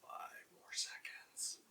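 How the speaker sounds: hushed countdown whisper. Real, not acted. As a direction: whisper it so as not to disturb.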